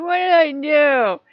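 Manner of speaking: startled protest. Retold or imitated. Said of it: "And I'm like".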